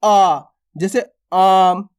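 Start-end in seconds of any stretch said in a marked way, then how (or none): 0.0-0.4 आ stretched into a long "aaa"; drawn-out vowel. Shown as a demonstration. Teacher shows pupils the stretched vowel, long emphatic आ. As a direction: hold आ with some force, mouth open. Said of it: "थोड़ा खींच के किया जाता है".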